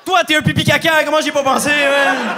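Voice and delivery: high, loud schoolyard jeer; childish insult shout. Retold or imitated. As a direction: yell it like a kid on the playground. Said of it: "t'es comme". (cri d'insulte enfantine, très aigu et fort)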